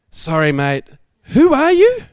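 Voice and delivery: baffled, who on earth is this; confused question. Retold or imitated. Speaker asks unknown caller, baffled high question. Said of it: "and I'm like".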